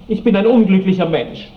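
dramatic, aggrieved wail of self-pity; wounded outburst. Acted. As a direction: protest your misery loudly. Sulking lover complains of being teased.

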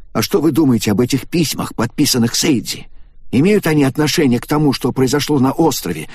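put-on eager young man. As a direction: push for an answer. Takaaki presses Shimada.

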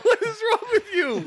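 high, loud, laughing disbelief; incredulous outcry. Real, not acted. Host to football player in clip, incredulous outcry. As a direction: cry it out high through a laugh.

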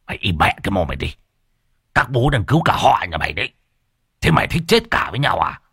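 fast, coarse telling-off; rough scolding. A narrator's reading. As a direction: shut him up roughly. Môn Phọt grabs Rêu's collar. (quát thô lỗ, nói nhanh)

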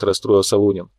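usual speaking voice

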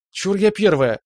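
put-on high and loud, eager kid; excited. A narrator's reading. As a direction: jump in first, bursting. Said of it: "подскочила сестричка".

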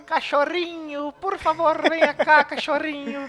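very high mock pleading voice; pleading dog call. Old man begging his lost dog, high comic pleading. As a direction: beg the dog to come in a hammed-up high voice. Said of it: "gritando, "Garboso".

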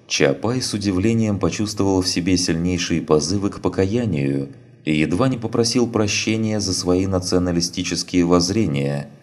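ordinary delivery, nothing marked

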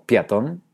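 PEATÓN as PIA-tón, rising question; transformed diphthong. Shown as a demonstration. Teacher offers the third option, piatón with altered vowel. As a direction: turn the E of peatón into I. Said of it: "transformando una de las dos vocales".

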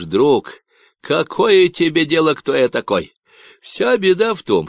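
loud, nasal Texan ranting about ecologists; a cocky stranger sounding off; Davy's Texan voice. Acted. Stranger on the radio ranting about ecologists, nasal Texan.

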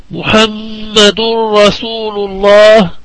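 slow recitation with the مّ of محمد held nasally; tajweed ghunnah recitation. Shown as a demonstration. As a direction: chant slowly, humming long on the doubled م of محمد. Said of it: "الميم المشددة من كلمة محمد".

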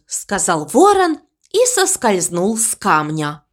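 ordinary delivery

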